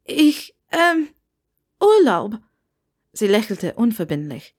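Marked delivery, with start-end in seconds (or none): 0.0-2.4 high, halting and caught out; flustered Hermine fumbling for a cover story; embarrassed stammer. Acted. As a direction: blurt an excuse after choking on tea. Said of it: "Verlegen hüstelte sie und räusperte sich".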